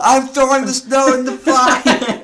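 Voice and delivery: high, loud, slow laughing yell; mock screaming Tyrion. Tyrion shouts while burning the note, a high, slow laughing yell. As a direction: bellow it through laughter. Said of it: "He's screaming too while doing it".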